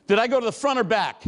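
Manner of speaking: ordinary delivery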